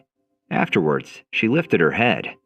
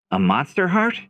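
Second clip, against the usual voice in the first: high, rising question of surprise; surprised; 10 semitones higher than usual. Acted. Hero spots the glowing core inside the beast, surprised. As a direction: ask it in amazed disbelief. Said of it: "eyes widened in surprise".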